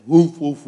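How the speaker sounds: loud mock dog bark; barking imitation. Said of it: "She barks at everything".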